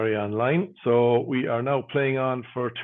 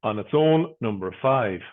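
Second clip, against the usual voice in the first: steady sing-song number calling; bingo caller. Acted. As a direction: call each number out, then repeat it.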